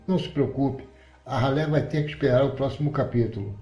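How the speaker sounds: plain reading voice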